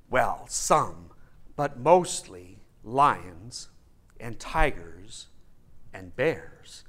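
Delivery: low, slow ominous list; Tin Man. Acted. Tin Man lists the beasts of the forest, ominously. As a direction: lay out each danger slowly in a deep voice. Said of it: "And the Tin Man goes".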